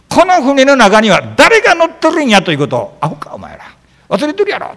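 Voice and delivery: loud, high Osaka-dialect scolding; hammed-up Osaka rant, dropping for the insult. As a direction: tell them off like an exasperated Osakan. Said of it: "大阪弁で分かりやすく言うと".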